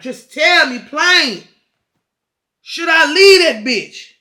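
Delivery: slow, loud, high demand; exasperated shouting. Retold or imitated. Client begging therapist for a straight answer, exasperated shout. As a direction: act out yelling, each phrase drawn out.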